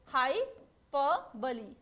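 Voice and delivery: हाइपरबोली said slowly, syllable by syllable: hai-per-bo-li; teacher modelling हाइपरबोली slowly; correct pronunciation. Shown as a demonstration. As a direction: spell out हाइपरबोली slowly with a clear final "li". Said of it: "क्या होगा correct pronunciation".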